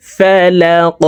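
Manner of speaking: ফালাক ending in a bounced echoing q; qalqala bounce. Shown as a demonstration. Teacher demonstrating qalqala, drawn out. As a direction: push the final q of ফালাক out. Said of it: "ওয়াকফের ক্ষেত্রে এই কফকে সাকিন".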